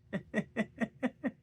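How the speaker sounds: bursts into a high laugh. Real, not acted. Laughs at own joke.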